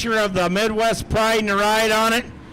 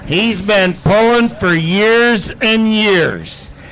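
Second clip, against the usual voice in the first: play-by-play announcer; 6 dB louder than usual. Acted. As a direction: sell the pull to the grandstand. Calls the pull over music.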